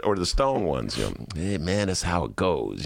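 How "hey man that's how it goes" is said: mellow stoner. Retold or imitated. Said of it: "kinda mellow about it".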